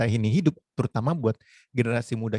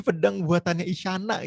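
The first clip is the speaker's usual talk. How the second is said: high-pitched imitated fan excitement; eager fan; 8 semitones higher, 3 dB louder than usual. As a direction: clamour playfully like a queuing fan. Acting eager fans queuing to buy.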